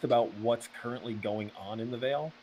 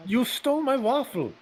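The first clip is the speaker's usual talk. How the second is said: high, loud indignant cry; outraged shout; 8 dB louder than usual. Acted. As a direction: yell about the stolen waffle. Character protests loudly.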